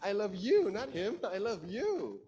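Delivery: loud, high emphasis on "you"; stress on you. Shown as a demonstration. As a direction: hit each "you" hard and long, contrasting it with "him". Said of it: "the one that I love is you".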